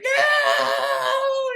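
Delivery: shrill, very high villain shriek; mock scream. Burned villain screaming, mock shriek. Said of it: "he's like".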